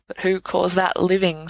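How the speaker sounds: usual speaking voice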